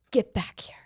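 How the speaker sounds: hushed urgent whisper. Retold or imitated. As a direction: hiss it under your breath.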